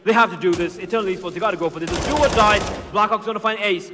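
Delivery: urgent play-by-play; esports caster. Acted. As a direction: push the round call with urgency. Play-by-play call.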